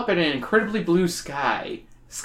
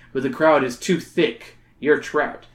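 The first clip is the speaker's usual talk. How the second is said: ordinary delivery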